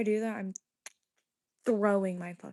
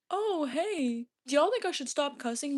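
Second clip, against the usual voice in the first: higher-pitched mocking fake request; mock-polite question; 5 semitones higher than usual. Streamer acting out a question never asked, mocking.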